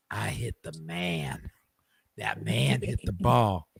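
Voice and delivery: slow, low and hushed; low whispered answer. Retold or imitated. Tatum answering the boy's question, slow low whisper. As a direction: let it drop into a near whisper, slow.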